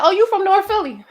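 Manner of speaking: playful impression of people abroad; imitating strangers. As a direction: mimic a surprised outsider. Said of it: "they're like".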